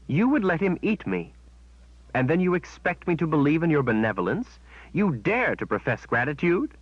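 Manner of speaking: rising outrage at the witch; indignant anger. Acted. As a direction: throw the betrayal back at her. Kalen confronts Shota in anger.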